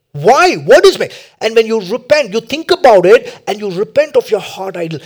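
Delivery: loud, high, urgent preacher delivery; full-force preaching. Real, not acted. Preacher urging listeners to identify their idol, full-force preaching. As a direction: drive each point home at high volume.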